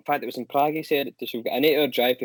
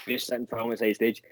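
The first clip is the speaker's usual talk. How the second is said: ordinary talk, nothing marked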